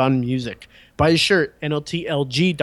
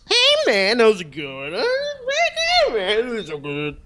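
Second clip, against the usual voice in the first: laid-back dude voice, much higher; Jeff Bridges impression; 7 semitones higher than usual. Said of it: "You guys wanna hear my Jeff Bridges?".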